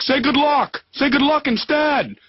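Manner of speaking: high, slow pushy prompt; inner voice. Retold or imitated. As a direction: back of the head cutting in. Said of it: "I wanted to say".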